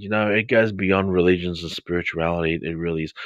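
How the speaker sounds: normal talking voice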